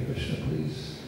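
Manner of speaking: soft, hushed plea to Krishna; whispered prayer. Retold or imitated. Praying quietly to Krishna while speaking to a listener, whispered. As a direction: breathe the prayer almost without voice. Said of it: "And I pray".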